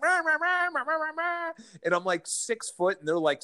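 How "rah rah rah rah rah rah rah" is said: high, cartoonish rah rah ranting; squeaky parody of an angry rant; mock yelling gibberish. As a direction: imitate yourself as a shouting blur. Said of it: "all they cut was me just like".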